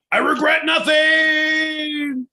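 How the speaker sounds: slow, high, loud proclamation; defiant shout. Acted. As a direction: bellow it out slowly. Grand defiant finish.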